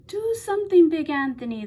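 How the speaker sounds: shouting. A narrator's reading. Townspeople shout at Big Anthony, frantic high shout. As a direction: shout it up in a panic. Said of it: "they shouted".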